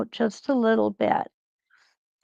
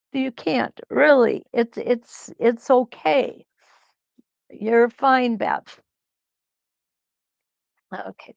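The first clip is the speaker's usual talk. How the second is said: ordinary talk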